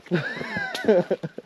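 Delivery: high squealing bray; donkey bray laugh. Pretend. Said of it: "Give me your best donkey impression".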